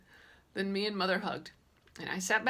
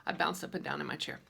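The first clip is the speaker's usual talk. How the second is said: ordinary delivery, nothing marked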